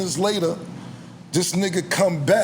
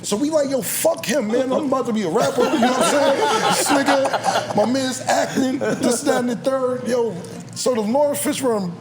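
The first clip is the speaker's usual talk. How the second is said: laughing while telling; 8 semitones higher than usual. Real, not acted. Laughing while retelling the scene.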